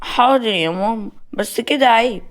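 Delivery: lightly voiced child, reluctantly obedient; child giving in. Child answers his mother.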